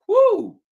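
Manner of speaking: high loud whoop of disbelief; loud whoo. Real, not acted. Commentator whooping at the mess, loud and high. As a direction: let out a big yelp. Exclaims after describing hungry Ukrainians.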